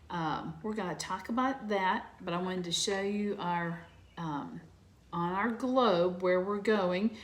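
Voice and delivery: ordinary delivery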